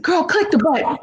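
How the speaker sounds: high, sassy insistence; lightly voiced pushy partner; insistent partner. Partner telling her to click the button, high and sassy.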